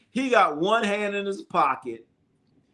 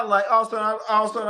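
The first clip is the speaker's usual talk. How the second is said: usual speaking voice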